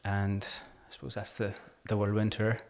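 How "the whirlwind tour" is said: ordinary talk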